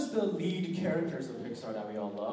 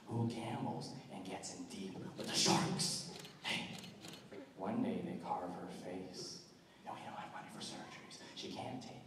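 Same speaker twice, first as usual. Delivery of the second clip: menacing villain; 5 semitones higher than usual. Acted. Cackling villain telling a captive about his scars. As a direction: taunt a victim, giggling madly, then drop to a whisper. Unnamed villain impression, scarred-face monologue.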